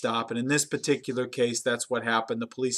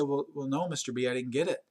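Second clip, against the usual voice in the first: meek admission; 8 dB quieter than usual. Retold or imitated. Employee admits failure meekly.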